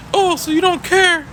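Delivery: put-on very high, loud whiny child voice; bratty student pleading for recess; whiny kid. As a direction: whine and protest like a cheated kid. Student accusing.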